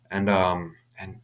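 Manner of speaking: ordinary delivery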